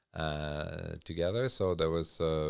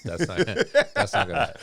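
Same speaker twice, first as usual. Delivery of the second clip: laughing; 13 semitones higher, 9 dB louder than usual. Real, not acted. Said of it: "laughing mid-sentence".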